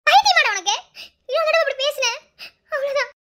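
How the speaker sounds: high, loud tearful scolding; angry outburst. Acted. Girl lashing out at her lover for talking of death, high tearful shout.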